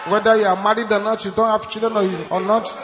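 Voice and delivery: loud, high preaching shout; fiery preaching. Real, not acted. Pastor rousing the congregation to pray, preaching at full force. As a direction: urge the church on at full force.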